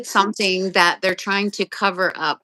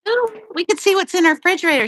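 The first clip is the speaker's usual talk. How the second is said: excited; 8 semitones higher, 3 dB louder, faster than usual. Real, not acted. Nosy peek at friend's kitchen.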